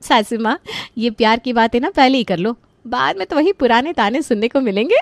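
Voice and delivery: high-pitched playful ribbing; teasing young in-law. Acted. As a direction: tease the mother-in-law playfully in a high, lively voice. Narrator voices teasing in-law character.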